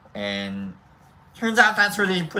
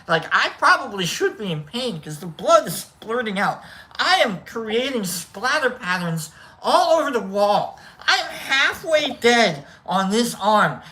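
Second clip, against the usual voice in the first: raised, emphatic mock-dramatic telling; hammed-up drama; 6 semitones higher, 3 dB louder than usual. Comic tells of bloody needle mishap, overdramatic. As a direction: play up the gore dramatically. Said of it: "I'm just making it sound dramatic".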